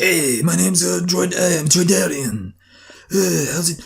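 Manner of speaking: high, drawn-out alien character voice; Troidarian alien. Acted. Host mimics a film alien introducing himself, high put-on Troidarian voice.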